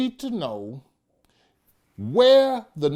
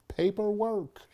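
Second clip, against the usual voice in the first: ordinary delivery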